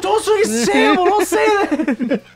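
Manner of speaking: very high, squealing mock protest; hammed-up teen meltdown; mortified teenager. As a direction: wail it like an embarrassed kid. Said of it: "I got a sixteen-year-old cousin".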